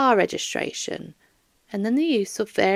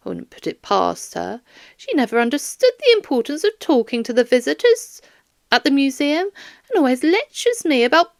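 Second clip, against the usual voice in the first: higher, rambling grandmother voice; chatty old lady; 6 semitones higher, 5 dB louder than usual. Acted. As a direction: let her ramble on about her job. Doris chatters about job, Layla.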